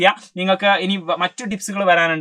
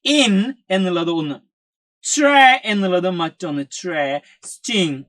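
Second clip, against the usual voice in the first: "in", "tray", "sting" said slowly, one syllable at a time; slow syllable-by-syllable "interesting"; slow syllable split; much slower than usual. Shown as a demonstration. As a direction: lay out each part slowly and clearly. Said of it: "നോക്കിക്കോ, ദാ പിടിച്ചോ".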